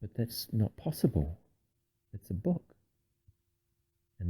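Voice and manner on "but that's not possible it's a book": much softer, hushed disbelief; quiet, uneasy talking to oneself; alarmed disbelief. A narrator's reading. Bastian telling himself it's a book, hushed alarm. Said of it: "Bastian wondered in alarm".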